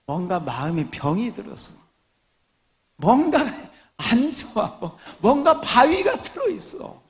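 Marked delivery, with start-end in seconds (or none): 3.0-7.0 much higher, louder teasing lament; mock concern. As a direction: tease the unlaughing crowd with fake pity. Mock pity for non-laughers.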